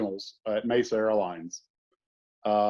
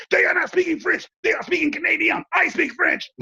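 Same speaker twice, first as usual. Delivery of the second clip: high-pitched, shouted mock tirade; imitated furious outburst. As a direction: explode like an outraged Frenchman losing it. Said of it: "he just lost it".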